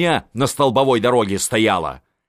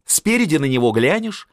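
ordinary delivery, nothing marked

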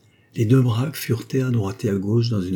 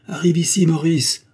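calling out; 9 semitones higher, 5 dB louder than usual. A narrator's reading. Father calling son over.

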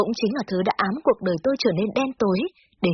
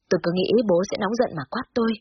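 plain reading voice

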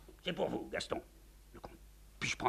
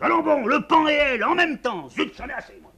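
loud, higher outburst; exploding; 15 dB louder than usual. Acted. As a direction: blow up in exasperation. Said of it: "Le général explose".

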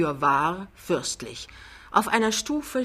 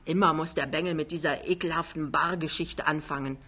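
usual speaking voice

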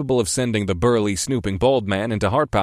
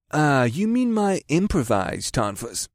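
slow, every word stretched out; drawn-out; 5 semitones higher, slower than usual. Acted. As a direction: draw it out like a sly salesman. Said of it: "carefully drawn-out voice".

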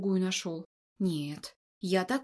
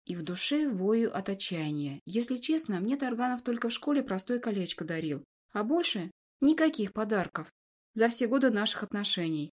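usual speaking voice

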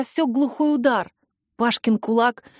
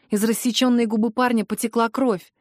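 ordinary delivery, nothing marked

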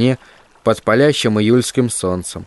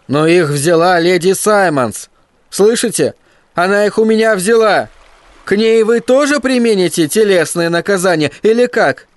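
put-on high, upset boyish protest; indignant boy; 8 semitones higher than usual. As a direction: plead your case, rising higher and higher.

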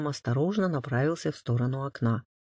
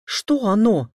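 loud, raised, urgent voice; alarmed question; 6 semitones higher, 9 dB louder, slower than usual. A narrator's reading. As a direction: demand to know, alarmed. Alarmed questioning after the scream.

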